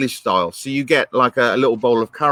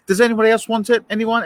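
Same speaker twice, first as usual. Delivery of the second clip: higher-pitched mock polite offer; politely offering diner; 8 semitones higher than usual. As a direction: lightly act out asking round the table. Voices polite diner offering last piece.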